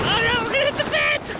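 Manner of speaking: very high, fast panicked cry; alarmed laughing cry. Real, not acted. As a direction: squeal as the fence comes closer. Sled heading for fence.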